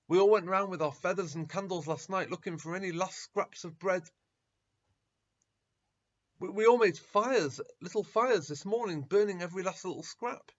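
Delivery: agitated protest. Acted. Disciples protest bread is all burned.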